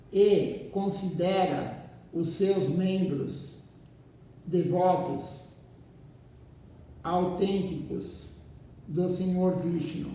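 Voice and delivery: slow, measured reading with pauses between phrases; leitura de livro em voz alta. Acted. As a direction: read the text out deliberately, phrase by phrase. Reads the book aloud to listeners. (leitura pausada, frase por frase)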